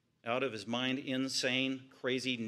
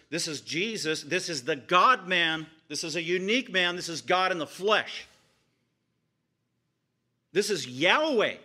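louder, slower proclamations building to Yahweh; preaching crescendo; 3 semitones higher, 6 dB louder than usual. Real, not acted. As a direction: proclaim each title with growing force. Preaching the revelation of Jesus.

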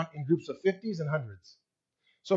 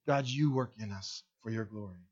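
much lower and softer, tender trust; calm trusting prayer; 8 semitones lower, 10 dB quieter than usual. Retold or imitated. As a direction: hush down into quiet faith. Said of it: "Instead of".

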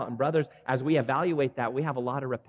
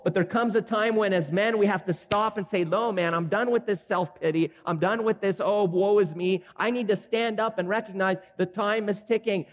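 higher and louder, full-force sermon delivery; rally the men from the pulpit, voice raised; fiery preaching; 8 semitones higher, 4 dB louder than usual. Acted.

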